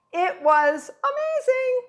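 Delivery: high, delighted squeal on amazing; thrilled, pitch jumps way up; gushing excitement. Real, not acted. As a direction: swoon over how amazing it was. Gushing about meeting a chef.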